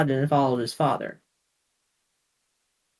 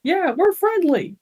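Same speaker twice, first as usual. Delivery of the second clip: put-on teen boy's voice; 13 semitones higher, slower than usual. Boris voiced high.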